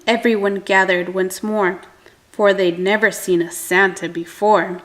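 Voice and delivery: ordinary delivery, nothing marked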